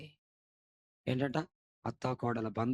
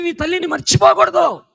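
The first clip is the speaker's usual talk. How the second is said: much higher, far louder shouted climax; climactic shout; 17 dB louder than usual. Acted. Preacher warning sons not to forget mothers, shouted climax. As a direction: shout it at the peak. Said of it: "loud take then quiet echo".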